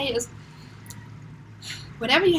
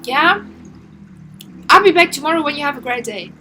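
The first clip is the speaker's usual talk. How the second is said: dismissive brush-off; 3 semitones higher, 9 dB louder than usual. Pretend. Dismissive partner brushing you off.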